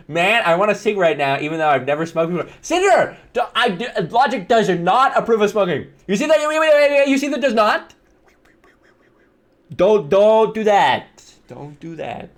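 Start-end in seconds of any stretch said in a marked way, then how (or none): 2.6-7.9 high-pitched, urgent correction; frantic protest. Real, not acted. Correcting himself on smoking to viewers, frantic high protest. 9.8-11.2 slow, drawn-out, raised-pitch plea; emphatic warning. Real, not acted. Warning viewers off smoking, slow emphatic plea. 11.5-12.3 hushed warning. Real, not acted. Warning viewers off smoking, hushed aside. Same warning repeated under the breath.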